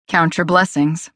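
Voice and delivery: slow, clipped curt reply; understated curt retort. A narrator's reading. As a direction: cut her off curtly. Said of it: "he said curtly".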